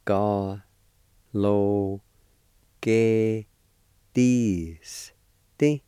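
"Ga, lo, ge, This. This." as isolated, drawn-out syllables; "Ga, lo, ge, This. This." with a pause after each syllable; syllable-by-syllable pronunciation. Shown as a demonstration. Teacher showing learners "Ga, lo, ge, This. This." syllable by syllable. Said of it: "It's pronounced like this".

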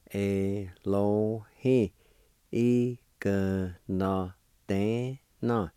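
very slow, syllables drawn out and separate. Shown as a demonstration. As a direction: stretch "Elohi. Igno de na" piece by piece.

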